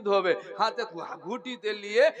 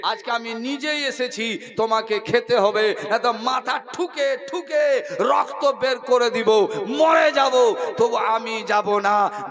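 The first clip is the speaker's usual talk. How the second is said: crying out that she will die; girl vowing to bleed at the idol; insistent girl's cry; 4 dB louder than usual. Acted. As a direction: shout the threat, then soften.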